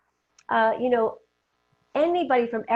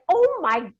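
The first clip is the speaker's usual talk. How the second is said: high, loud shocked gasp of disbelief; shocked exclamation; 10 semitones higher, 4 dB louder, slower than usual. Retold or imitated. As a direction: blurt it out in astonishment. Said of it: "I was like".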